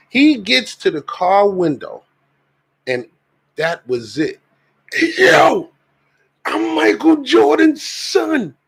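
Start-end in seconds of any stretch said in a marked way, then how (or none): 5.2-8.5 high, slow, loud street yell; shouting stranger. Retold or imitated. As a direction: yell it like a wild stranger at the window. Man shouting at car window.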